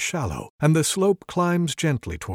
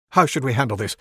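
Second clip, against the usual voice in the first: panicked questions; 5 semitones higher, 6 dB louder, faster than usual. Acted. Wizard panics.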